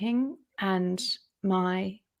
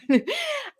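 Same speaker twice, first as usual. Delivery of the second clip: high laugh. Real, not acted. Laugh after the story.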